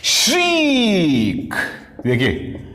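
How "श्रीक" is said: high, long "श्रीक" gliding down; imitated shriek. Shown as a demonstration. Teacher demonstrating onomatopoeia, high drawn-out shriek. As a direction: turn the word into a mock shriek. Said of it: "onomatopoeia".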